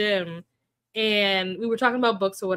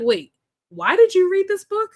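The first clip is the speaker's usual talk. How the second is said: high, loud baffled question; retold bafflement, pitched up sharply; confused disbelief; 9 semitones higher, 6 dB louder than usual. Streamer retelling her reaction to a friend's book choice, confused disbelief. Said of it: "I was very confused".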